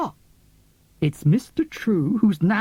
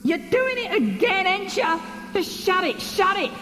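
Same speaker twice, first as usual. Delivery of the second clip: put-on much higher, snapping young lad; angry boy; 8 semitones higher than usual. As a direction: bite back at him, fed up. Boy snaps at the captain.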